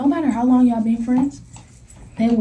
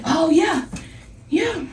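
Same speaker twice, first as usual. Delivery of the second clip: high, slick taunting adult voice; taunting mom; 6 semitones higher, 4 dB quieter than usual. Retold or imitated. Girl's mom taunting the narrator, high slick tone. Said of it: "she like".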